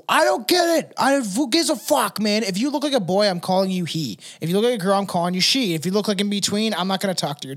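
hammed-up exasperated rant; mock rant. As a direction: rant like a fed-up guy. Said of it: "I'm like, I'm like".

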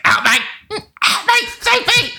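very high strangled screech; hammed-up strangled cry for help; choking screech. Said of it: "she's screeching and she's choking".

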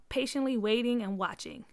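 ordinary delivery, nothing marked

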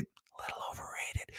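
very soft, voiceless confession; whispered aside. Pretend. Comedian secretly undercutting his pizza compliment to New Yorkers, whispered aside. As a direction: slip it in under your breath as a whisper.

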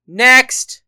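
loud, high clerk's yell; sharp rise into a shout; yelled call. A narrator's reading. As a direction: call out for the next customer. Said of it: "he yelled".

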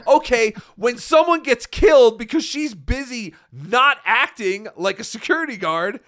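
fired up, hammering the point about the guard; heated outburst. Real, not acted. Ranting about the fired guard, loud and heated. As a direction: voice jumps up loud and high, pushing hard.

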